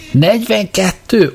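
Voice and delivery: Lunkaul's lightly acted outburst at the answer; mock yell. A narrator's reading. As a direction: yelp the number in disbelief. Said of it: "ordította Lunkaul".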